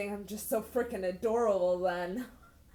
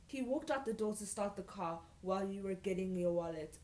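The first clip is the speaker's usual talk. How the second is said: usual speaking voice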